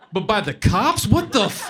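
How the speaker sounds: disbelief. Real, not acted. Punchline disbelief.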